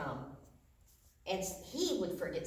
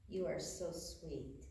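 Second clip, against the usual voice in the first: soft, low and slow endearment; tender mama; 4 semitones lower, 10 dB quieter, slower than usual. Retold or imitated. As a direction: say it softly, slowly, as a doting mother. Retold tender words from mama.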